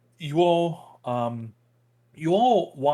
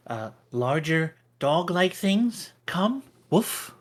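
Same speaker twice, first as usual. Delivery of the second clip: squirrel. Acted. GM voices squirrel at length.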